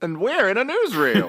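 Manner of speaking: high mock-newsreel voice; old newsreel announcer. Host joking they are in a newsreel, announcer voice. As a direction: put on a newsreel announcer's pitch. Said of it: "Like we were doing earlier".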